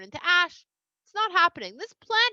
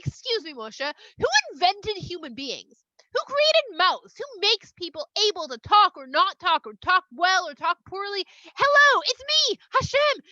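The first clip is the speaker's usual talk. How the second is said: high, loud, incredulous questioning; indignant; 6 semitones higher, 7 dB louder than usual. Retold or imitated. Hashem rebuking Moshe for doubting his speech, indignant. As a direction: build to an exasperated, drawn-out reminder.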